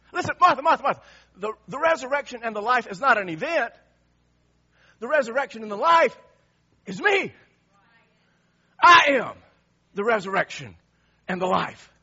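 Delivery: loud, emphatic proclamation; preaching at full force. Acted. Preacher proclaiming Jesus's words to Martha, loud and fervent. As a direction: thunder out each word.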